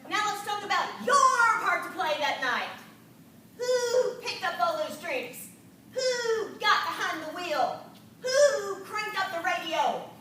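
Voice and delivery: stern, loud questioning mentor; drill sergeant. Retold or imitated. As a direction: grill her like a strict sergeant. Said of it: "she spoke like a drill sergeant".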